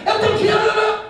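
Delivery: pastor mimics a proud man bragging, hammed-up; mocking braggart. Mocking a proud man's boast.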